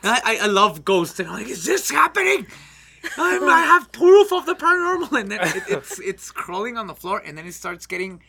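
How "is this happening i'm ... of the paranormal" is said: higher, louder, laughing thrill; gleeful at possible ghost; thrilled ghost fan. Retold or imitated. Thinking he sees a ghost, excited and laughing.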